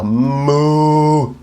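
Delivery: held open moo; cow moo. Shown as a demonstration. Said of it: "like a cow".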